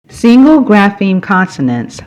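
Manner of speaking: normal talking voice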